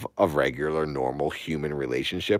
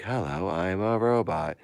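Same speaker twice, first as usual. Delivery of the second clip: lightly voiced female robot; a hint of robotic sing-song; woman robot; 3 semitones higher than usual. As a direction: imitate a robot woman's greeting. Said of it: "woman speaking like".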